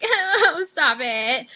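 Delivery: loud mock-tearful whine, starting high; mock whining. Student reacts to being yelled at every day, mock whining. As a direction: wail it in mock protest.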